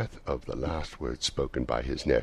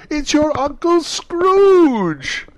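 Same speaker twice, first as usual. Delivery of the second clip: high, loud gleeful party cry; excited shout; 14 dB louder, much slower than usual. Acted. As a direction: shout the answer with delight. Guest revealing the answer.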